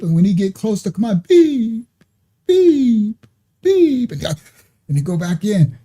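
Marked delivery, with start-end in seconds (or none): 1.3-4.1 mock beeps climbing higher; each beep pitched up a notch; rising monitor beeps. Imitating the baby's heart monitor, beeps climbing higher.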